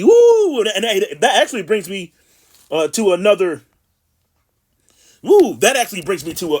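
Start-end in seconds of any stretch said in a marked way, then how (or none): 0.0-0.6 high, loud "woo"; excited whoop. Real, not acted. Preacher breaking off his scripture reading, excited high whoop. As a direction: let out a sudden high whoop. Exclaims after reading the verse.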